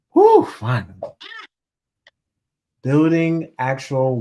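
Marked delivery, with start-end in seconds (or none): 0.1-1.0 loud "whew", relieved listener; relieved sigh. Retold or imitated. Mocking a weary listener near the end, relieved whew. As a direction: sound glad it's almost over. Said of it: "I know you're like".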